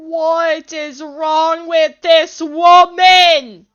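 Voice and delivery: slow, very loud, drawn-out shout; exasperated shout. Real, not acted. Player exasperated by this woman, slow shout. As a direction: bellow each word slowly in disbelief.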